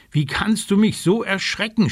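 cheeky little raven scolding. Acted. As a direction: tell him off sharply. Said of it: "schimpft er".

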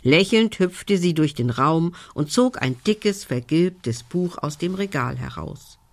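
plain reading voice

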